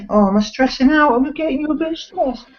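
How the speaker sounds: put-on high baby-talk to the dog; soothing the pet in a high sing-song. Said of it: "I'm stressing my dog out".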